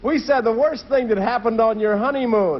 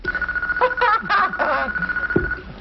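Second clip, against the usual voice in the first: high, long burst of laughter; real laughter; 13 semitones higher than usual. Real, not acted. Husband hears his wife said sewer cover, laughing. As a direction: crack up at the clue.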